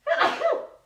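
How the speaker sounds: loud sudden cough; coughing hard into the mic; real cough. Real, not acted. Crafter on livestream coughs loudly, real cough.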